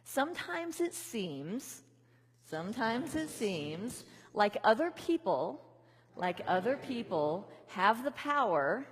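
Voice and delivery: slow, halting call for the group to repeat; feed it in small slow pieces; slow phrase-by-phrase call. Shown as a demonstration. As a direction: lead the room line by line. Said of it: "chop them up into little pieces so that you can repeat them back".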